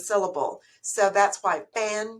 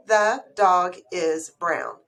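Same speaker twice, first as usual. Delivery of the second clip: each word set apart, slow and clear; 2 semitones lower, 5 dB louder, much slower than usual. Shown as a demonstration. Teacher counting each word slowly for the class. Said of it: "That's four words".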